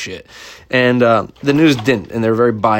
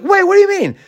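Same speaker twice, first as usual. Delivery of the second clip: very high loud puzzled question; puzzled dog voice; 3 dB louder than usual. Acted. Dog asking the girl about losing, high puzzled squeak. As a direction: squeak it out like a confused pup.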